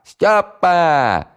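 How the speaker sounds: high, long, loud drawn-out call; bellowing again. Acted. Housewife calls Styopa a second time, drawn-out and loud. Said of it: "заорала во всё горло".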